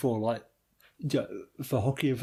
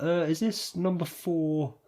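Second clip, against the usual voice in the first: gas man; 5 semitones higher, slower than usual. Retold or imitated. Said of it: "British gas geezer that's like".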